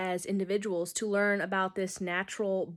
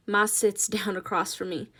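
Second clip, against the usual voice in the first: ordinary delivery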